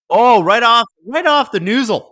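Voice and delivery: high-pitched live reaction; startled, high sports call; excited outburst. Real, not acted. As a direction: exclaim it high as the shot hits. Live reaction to puck hit.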